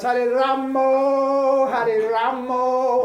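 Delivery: put-on loud, drawn-out Bengali-accented chant. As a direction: sing it out with round Bengali O endings. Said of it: "in Bengal, it's".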